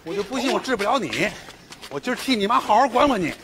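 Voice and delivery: high-pitched, worked-up scolding; angry threat. Acted. Man disciplining a boy for his mother, angry. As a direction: threaten to teach the child a lesson.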